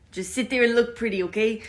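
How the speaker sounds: put-on a light Italian mobster accent, half joking; a hint of a New York Italian father; creepy Italian father accent. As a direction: sound like an Italian mob dad, playful. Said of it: "my creepy Italian father accent".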